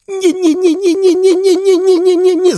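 a distraught duck refusing to believe; duck wailing no. Acted. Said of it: "zawołała".

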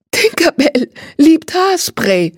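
high, slow, sugary put-on cheer; sugary and strained, spoken through a crooked smile; forced sweetness. A narrator's reading. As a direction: cover the embarrassment with a crooked smile. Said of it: "sagt sie und lächelt schief".

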